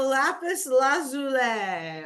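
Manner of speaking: lazuli said as one of several options, last syllable drawn out; variant pronunciation. Shown as a demonstration. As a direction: offer lazuli slowly as one way to say it. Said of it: "whatever you wanna say".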